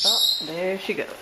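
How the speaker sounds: normal talking voice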